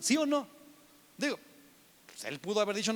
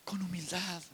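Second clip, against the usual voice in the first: breathy whisper of Con humildad; whispered answer; 8 dB quieter than usual. Real, not acted.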